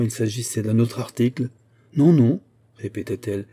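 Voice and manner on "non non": a louder, higher, insistent "non, non"; stubborn refusal. A narrator's reading. The customer stubbornly contradicting the shopkeeper, insistent. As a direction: dig in and refuse to budge. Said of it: "avec l'entêtement d'une bourgeoise".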